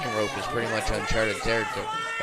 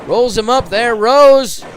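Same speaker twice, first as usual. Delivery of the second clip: wrestling commentator; 10 semitones higher, 11 dB louder than usual. Real, not acted. Sudden roll-up near fall.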